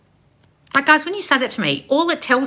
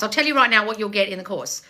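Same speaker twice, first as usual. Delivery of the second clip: normal talking voice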